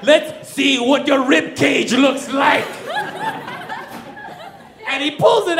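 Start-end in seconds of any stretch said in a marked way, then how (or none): 0.0-2.6 menacing thug. Retold or imitated.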